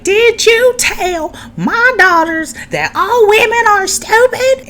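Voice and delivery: very high, slow mock screech; imitated angry yelling. Angry mother confronting him about her daughters, mock screeched. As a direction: stretch every word in a hammed-up high yell. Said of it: "She's going off, being like".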